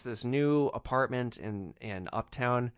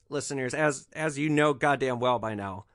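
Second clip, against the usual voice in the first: ordinary delivery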